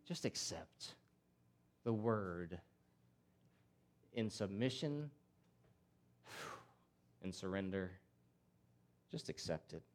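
low, soft, with long pauses; hushed appeal. Real, not acted. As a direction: let it sink to a quiet plea. Urging surrender, voice drops low.

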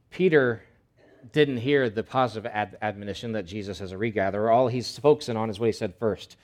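ordinary delivery

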